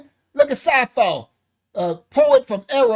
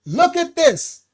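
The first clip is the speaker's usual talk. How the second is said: ordinary delivery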